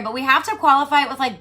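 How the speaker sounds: ordinary delivery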